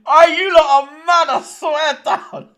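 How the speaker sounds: loud, high laughing exclamation; cracking up at the chat; helpless laughter. Real, not acted. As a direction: burst out, dragging the words through laughter. Cracking up at the chat's joke.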